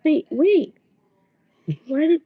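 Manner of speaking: high, loud panicked wait; frantic Zenitsu. Retold or imitated. Boy yells after the girl who is leaving him, panicked. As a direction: cry out to stop her leaving.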